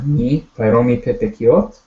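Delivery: "Mi pai romi pe pekiot" with a final rise on "pekiot"; question inflection. Shown as a demonstration. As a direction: model the question, voice climbing on "pekiot". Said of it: "your voice goes up at the end".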